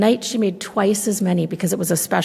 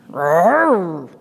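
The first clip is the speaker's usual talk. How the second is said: mock bear growl; 2 semitones lower, 4 dB louder than usual. Bear answers grandma's question with a growl. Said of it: "Old Bear growled".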